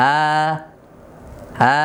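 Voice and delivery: আ as a long, light front vowel; thin alif. Shown as a demonstration. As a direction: hold an unrounded 'aa' steadily. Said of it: "এই উচ্চারণটা বারিক হচ্ছে".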